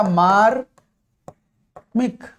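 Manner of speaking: very slow, every sound stretched; teacher dragging out मार्मिक as the answer; drawn-out answer. Shown as a demonstration. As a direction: stretch मार्मिक out syllable by syllable, long aa in मा. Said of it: "शब्द क्या बन जाएगा?".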